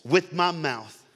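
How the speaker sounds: led prayer. Real, not acted. Preacher leading the congregation in a repeat-after-me prayer, projected. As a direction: call it out for the room to repeat.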